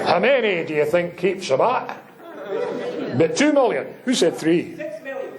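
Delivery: a light mock Scottish accent, laughing. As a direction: ask it again, lightly imitating a Scottish accent. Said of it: "Did he do this in a Scottish accent?".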